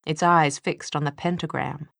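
ordinary delivery

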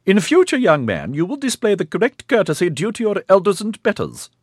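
put-on high, loud snapping old woman; stern old matriarch. A narrator's reading. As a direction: scold him like an affronted dowager. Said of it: "she snapped at him".

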